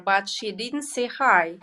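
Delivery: ordinary delivery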